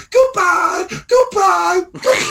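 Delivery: very high, silly squeaked farewells; mocking falsetto sing-out; childish taunt. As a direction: wave them off like a gleeful little child. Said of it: "like the little child that I am".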